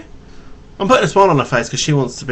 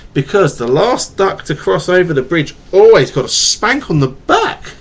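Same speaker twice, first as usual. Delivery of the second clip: storybook read-aloud to a little one; reading to a child; 2 semitones higher, 3 dB louder than usual. Acted. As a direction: read it gently like a bedtime tale.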